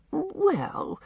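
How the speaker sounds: a grudging, drawn-out well; reluctant well. A narrator's reading. Susan, pressed by Anne, gives in reluctantly. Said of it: "admitted Susan reluctantly".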